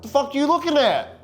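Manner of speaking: a deputy yelling, spooked; alarmed yell. Retold or imitated. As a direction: call across to a kid staring into the woods. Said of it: "petrified to the point where I'm like".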